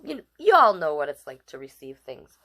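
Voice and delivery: plain reading voice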